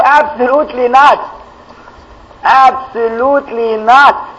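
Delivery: normal talking voice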